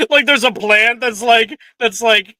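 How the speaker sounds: amused, loud and high, breaking into laughs; laughing. Real, not acted. Picturing a plant in its pot, laughing.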